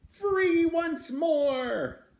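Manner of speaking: very slow, high and loud triumphant call; joyful cry. Acted. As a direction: shout it out like a freed animal. Beast escapes through the window.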